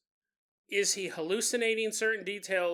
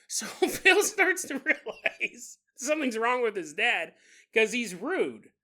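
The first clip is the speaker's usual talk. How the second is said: normal talking voice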